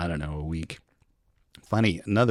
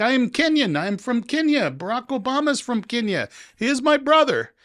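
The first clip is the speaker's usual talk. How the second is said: much higher put-on officer voice; imitating the officer; 5 dB louder than usual. Immigration officer teasing the American traveler, high put-on voice. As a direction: play the passport officer, pitched way up and sly. Officer proudly names Kenya.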